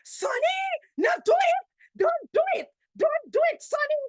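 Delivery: high, loud pleading yell at the neighbour; retold shouting. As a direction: call out to stop a fight. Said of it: "she shouting".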